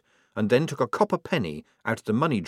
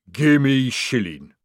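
slow troll voice; 3 semitones higher, 6 dB louder, much slower than usual. Acted. Troll demands a coin.

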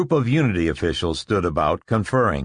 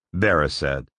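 usual speaking voice

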